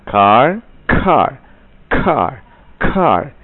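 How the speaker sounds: "car" with a puff of air on the k; car repeated, k exploding; aspirated car drill. Shown as a demonstration. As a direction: burst the k in "car" each time.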